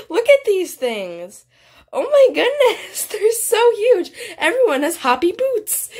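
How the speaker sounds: high-pitched, giggly amazement; amazed excitement. Real, not acted. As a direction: marvel at it in a high voice. Amazed at huge shoes in game.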